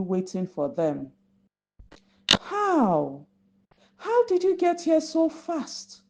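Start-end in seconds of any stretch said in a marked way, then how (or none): 2.4-6.0 a beaten fish gasping out the question; astonished stammer. A narrator's reading. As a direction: voice jumping much higher in disbelief. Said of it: "Zip stammered".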